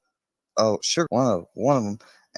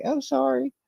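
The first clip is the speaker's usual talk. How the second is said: much higher, squeaky sorry; dog's apology voice. Pretend.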